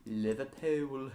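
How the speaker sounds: slow practice stab at Scouse; slow, amateur Scouse accent; Liverpudlian accent attempt. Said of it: "if that was Welsh or Liverpudlian".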